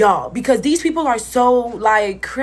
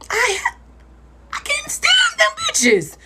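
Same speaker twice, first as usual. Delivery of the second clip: real sob breaking into a high, slow shout; crying shout; slower than usual. Real, not acted. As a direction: cry it out, voice cracking way up. Retelling abuse, breaks down.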